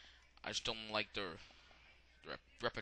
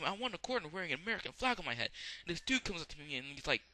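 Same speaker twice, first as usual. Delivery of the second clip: ordinary delivery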